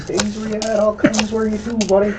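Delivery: high playful Newfoundland dialect; Newfoundland accent. Pretend. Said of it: "getting up in the Newfoundland".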